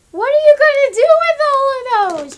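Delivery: loud, high shocked cry; loud, high what-are-you-doing cry; shocked outburst. Retold or imitated. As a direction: blurt it out in loud disbelief. Exasperated quote at viewers.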